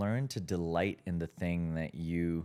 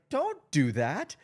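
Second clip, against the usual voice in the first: higher, louder and slower retold warning; slow firm warning; 9 semitones higher, 6 dB louder than usual. Friend quoting the host's warning again, slow and firm. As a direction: lean on each word as a firm don't. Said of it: "You're like".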